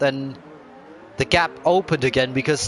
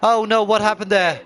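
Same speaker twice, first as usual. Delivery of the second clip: excited commentary; 7 semitones higher, 3 dB louder than usual. Real, not acted. Retirement announced live.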